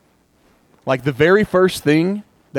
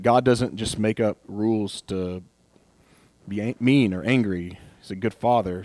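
ordinary talk, nothing marked

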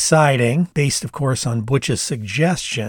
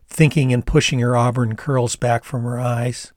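ordinary delivery, nothing marked

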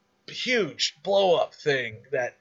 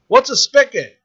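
high, louder puzzled question; mock baffled friend; 11 semitones higher, 8 dB louder than usual. Friend who can't find the spigot: high, baffled question. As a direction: play the clueless guy asking, higher.

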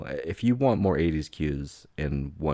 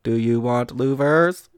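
robot voice; 6 semitones higher, 9 dB louder, slower than usual. Pretend. Continues the car robot persona.

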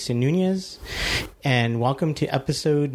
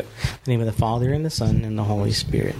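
prayer. Acted. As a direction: recite it like a litany leader. Leads the opening prayer.

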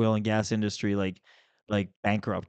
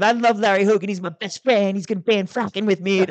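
high, loud sing-song parody of a fawning colleague; mocking imitation; 9 semitones higher, 4 dB louder than usual. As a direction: ham up a bobbleheaded yes-man. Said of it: "bobbling his head like a bobblehead".

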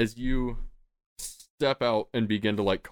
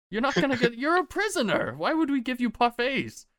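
very high, fast squeaky goblin; exasperated goblin. Acted. Goblin guard scolding the prisoner about parfaits, high and squeaky. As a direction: squeal it out in disbelief.

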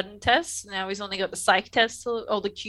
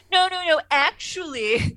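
know-it-all actually; 7 semitones higher, 3 dB louder, slower than usual. Retold or imitated. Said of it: "Wesley does a little "actually" moment".

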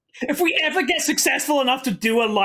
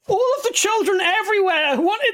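put-on whiny child voice; 9 semitones higher than usual. Acting as an upset child.

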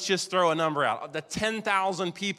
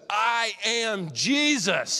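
"I am Jesus" very slow, stress on "Jesus"; solemn slow declaration; 4 semitones higher, 3 dB louder, much slower than usual. Acted. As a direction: declare it slowly, with weight. Jesus announcing himself.

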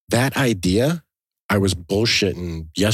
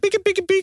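squeaky beep noises; high beeping imitation. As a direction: chirp like a machine. Imitating button beeps.

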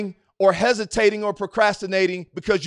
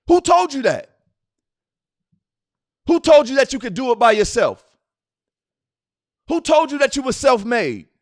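rising, loud, repeated challenge; preaching at full force; 6 semitones higher, 6 dB louder than usual. Acted. Preacher challenging the congregation, rising and loud. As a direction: hammer the question home to the crowd.

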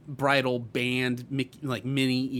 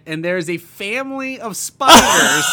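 rising alarm, louder and higher; alarmed outburst; 7 semitones higher, 10 dB louder than usual. Retold or imitated. Telling a friend about spiders in the hat, shrill alarm. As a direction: blurt out the shock of finding spiders.

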